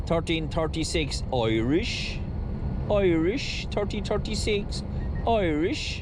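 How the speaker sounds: put-on slow Irish accent, last word swooping up; mock-Irish lilt, slowly savoured; Rory's Irish catchphrase. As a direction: draw out an exaggerated Irish accent with a rising finish. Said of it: "as Rory says".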